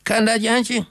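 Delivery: high, pleading near-cry of a dying husband; almost crying out her name in despair; near shout. A narrator's reading. Dying Gert nearly cries out to his wife, high and desperate. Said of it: "Hij schreeuwde bijna uit".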